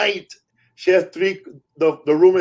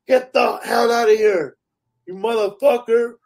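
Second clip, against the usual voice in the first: mock scared yell; 5 semitones higher than usual. She bangs pipe on door.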